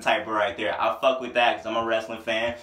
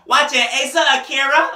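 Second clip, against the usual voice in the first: high, loud mimicked rap delivery; 12 semitones higher, 8 dB louder than usual. Reviewer quoting a perverted bar, high rapper impression. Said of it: "[name] spitting the typical [name]".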